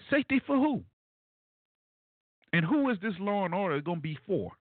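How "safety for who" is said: "Safety for who?" pitched much higher in disbelief; incredulous question. Shown as a demonstration. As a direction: ask it again, higher and incredulous.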